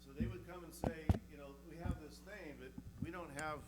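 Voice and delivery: teacher voice. Real, not acted. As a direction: project it to the back row like a teacher. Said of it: "I'll use my teacher voice".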